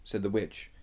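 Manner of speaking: ordinary delivery, nothing marked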